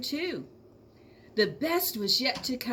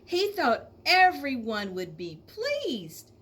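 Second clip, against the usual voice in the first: ordinary delivery, nothing marked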